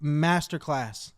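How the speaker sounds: ordinary delivery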